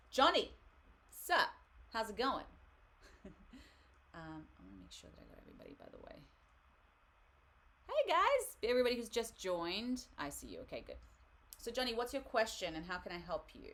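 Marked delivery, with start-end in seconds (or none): 0.1-2.4 high, loud, punchy hello; playful loud greeting. Real, not acted. Reader greets the next viewer with a question, playfully loud. 7.9-10.0 high, loud call to newcomers; big greeting. Real, not acted. Host welcomes everybody who just joined, loud and high.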